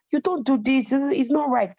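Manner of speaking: high, scolding counselor; stern counselor. Retold or imitated. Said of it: "the counselor was telling me".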